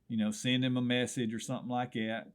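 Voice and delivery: usual speaking voice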